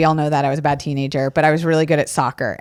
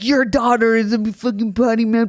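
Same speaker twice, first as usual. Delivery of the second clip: complaining coach; 4 semitones higher than usual. Retold or imitated. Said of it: "being like".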